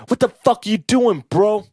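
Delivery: loud, high-pitched incredulous demand; imitated screaming cop. Cop confronting a guy at the band's van, angry mock yell. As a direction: lay into him like an outraged cop. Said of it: "he's screaming".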